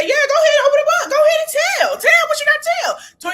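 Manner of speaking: high, loud, fast cackling dare; laughing taunt. Retold or imitated. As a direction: laugh while daring her to tell. Imitating Heavenly's laughing taunt.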